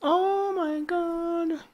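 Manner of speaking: high, slow drawn-out oh my God; stunned gasp. Real, not acted. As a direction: let it out slow and high in shock. Reacting to a new message.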